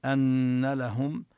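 slow أن لهم with the doubled nun nasal held long; clear nasal. Shown as a demonstration. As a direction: hold the nasal on أن. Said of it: "نبين الغنة فيهما".

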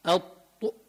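الط- held slowly with the tongue pressed up, heavy ط; emphatic ط demo. Shown as a demonstration. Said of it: "مفخم لأنه من حروف الاستعلاء ومطبق".

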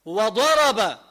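dad said with full tongue-edge lengthening; وضرب with a drawn-out heavy dad; Quranic pronunciation. Shown as a demonstration. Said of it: "إذا بدك تقول".

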